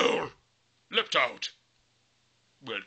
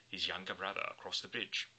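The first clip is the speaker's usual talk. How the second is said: usual speaking voice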